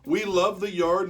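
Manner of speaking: ordinary delivery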